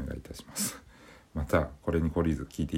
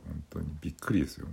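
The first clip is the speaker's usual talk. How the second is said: normal talking voice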